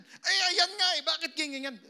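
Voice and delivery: loud, high exasperated scolding; insistent boss. Retold or imitated. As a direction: push it like an impatient boss. (malakas at naiinis na amo)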